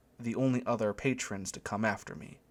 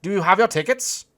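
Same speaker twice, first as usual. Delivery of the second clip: put-on high, loud German-accented question, rising end; announcing; 9 semitones higher, 9 dB louder than usual. A narrator's reading. German usher asking for tickets, loud accented announcement. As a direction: ask it officially in a German accent. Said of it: "he announced".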